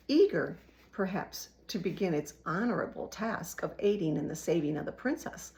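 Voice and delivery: plain reading voice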